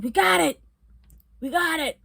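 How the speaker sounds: high, mock triumphant shout; imitated officer's call. Imitates officers calling out find.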